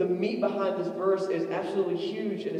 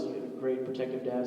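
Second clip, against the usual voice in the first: ordinary delivery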